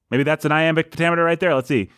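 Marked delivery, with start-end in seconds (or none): none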